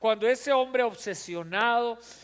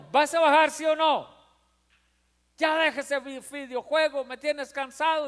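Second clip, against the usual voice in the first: fed-up mother; 5 semitones higher than usual. Retold or imitated. Fed-up mother ordering her son off the video game. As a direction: scold the kid to put the game down. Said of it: "Ya no".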